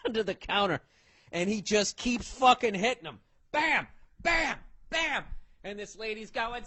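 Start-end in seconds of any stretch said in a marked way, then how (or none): 3.5-5.2 higher yelled bam blows; mock hits, higher and shouted. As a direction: yell each bam like a blow landing. Imitating pipe hits.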